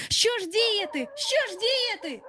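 high, frantic worried voice; panicked. A narrator's reading. Lost boys facing wolves, panicked and frantic. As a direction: fret aloud, lost and scared.

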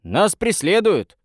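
mild narrated shout. A narrator's reading. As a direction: lightly call out a warning. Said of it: "крикнул в ответ рыбак". (негромкий протяжный окрик)

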